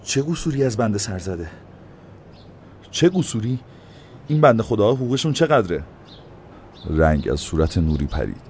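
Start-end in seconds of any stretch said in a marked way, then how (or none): 0.0-1.5 higher, fearful, timid question; timid fearful «چه قصوری»; trembling. A narrator's reading. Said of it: "با ترس و لرز گفت". 2.9-5.8 indignant challenging «چه قصوری»; indignant echo. A narrator's reading. Mayor throws the words back.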